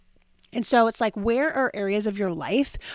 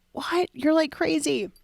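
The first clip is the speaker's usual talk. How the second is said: incredulous; 8 semitones higher, slower than usual. Retold or imitated. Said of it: "he'd be like".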